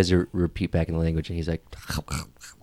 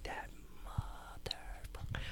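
menacing whisper; 21 dB quieter than usual. Acted. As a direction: whisper it to yourself, drawing it out. Spotting a cheating monkey.

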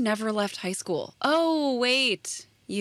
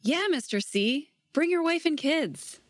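mocking Sarah; 6 semitones higher than usual. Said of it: "mocking Sarah's farewell".

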